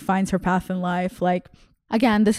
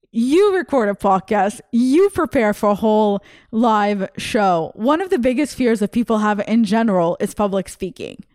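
usual speaking voice

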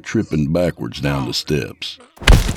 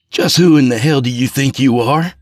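angry; 9 semitones higher, 5 dB louder than usual. A narrator's reading. Jeff confronts landlord.

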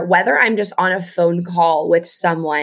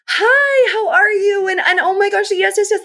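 much higher, bright eager greeting; high people-pleaser voice; 13 semitones higher than usual. Retold or imitated. Mom-taught caller to a stranger, in a high eager voice. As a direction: pitch way up to sound pleasing. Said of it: "my voice goes up".

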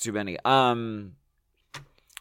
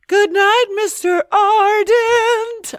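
very high, slow, sung-out mock greeting; mock grand announcer; 6 dB louder, slower than usual. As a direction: stretch it out like a campy presenter.

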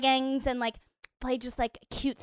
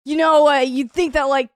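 louder, showing off with a joke; loud joking; 8 dB louder than usual. Retold or imitated. Barista to her cool crush, a loud show-off joke. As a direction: play it up big for the crush. Cracking a joke to impress Xander.

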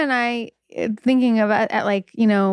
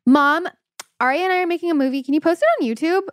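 put-on higher-pitched, quick kid asking mom; young daughter; 8 semitones higher, faster than usual. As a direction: imitate your daughter's eager request, pitched up and rushed. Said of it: "but she'll be like".

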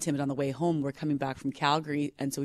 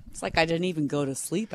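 ordinary talk

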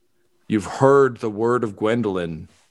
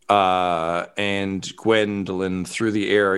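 usual speaking voice

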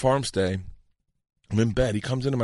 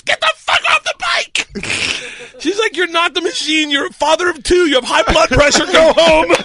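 high, exasperated wife; high-pitched exasperated nagging; scolding wife; 13 semitones higher, 4 dB louder than usual. Retold or imitated. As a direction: scold him like a fed-up wife.

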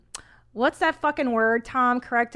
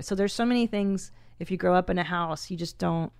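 ordinary talk, nothing marked

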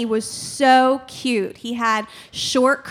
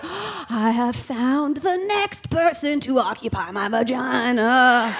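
hushed, reverent mock wonder; awed revelation. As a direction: announce it like a sacred discovery.

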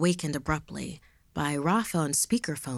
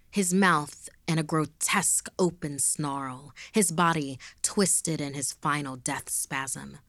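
plain reading voice

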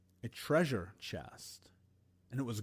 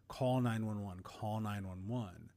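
ordinary talk

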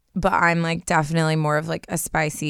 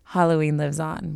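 ordinary talk

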